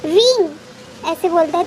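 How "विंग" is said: mild mock yell of a name, a bit higher; retold shout. Waking up beside his friend, he calls his name in a mild mock yell. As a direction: call out the name in a half-hearted startled yell. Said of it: "तो वो ज़ोर से चिल्लाता है".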